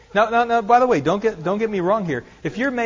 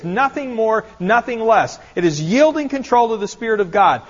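loud, high, driving sermon delivery; louder, higher pulpit preaching; full-force preaching; 5 semitones higher, 5 dB louder than usual. Acted. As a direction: preach it out, rising to the Spirit.

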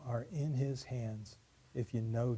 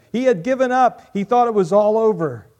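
loud, higher, rising preacher's climax; full-voiced pulpit storytelling; preaching at full force; 9 semitones higher, 16 dB louder than usual. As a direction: build the story to a ringing peak. Preaching the servant's despair.

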